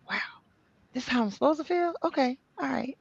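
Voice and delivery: soft, high-pitched wonder; retold amazed discovery, light and soft; pleasant surprise. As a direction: marvel quietly, voice lifted high. Retold wonder at feeling better.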